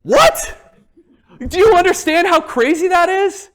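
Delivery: high, loud disbelieving What; amazed, laughing at how crazy it is; surprised. Real, not acted. Amazed at seeing online status.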